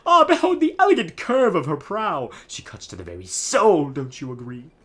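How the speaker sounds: grand, high theatrical nobleman voice; pompous Flavio. Acted. Streamer voicing Flavio to the player, hammy pompous. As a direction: ham up the vain pirate-loving noble.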